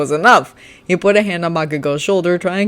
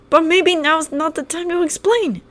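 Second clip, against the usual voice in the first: put-on higher, careful boy's voice; Kirishima voice; 12 semitones higher than usual. Kirishima carefully raising the past with Bakugo, higher. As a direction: try to reason gently. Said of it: "Kirishima continued".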